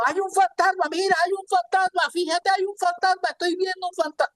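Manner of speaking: high, rushed panic on one pitch; hammed-up squealing panic, line tumbling after line; mock frightened voices. Panicked disciples calling to each other about a ghost, afraid. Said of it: "Y dieron voces de miedo".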